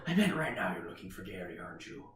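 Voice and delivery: put-on low, hushed Irish accent; Liam Neeson. Kidnapper taunts SpongeBob, soft low Irish accent. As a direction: menace quietly in an Irish accent. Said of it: "Yes, he is Irish".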